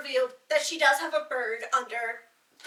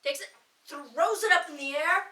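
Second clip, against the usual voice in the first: ordinary delivery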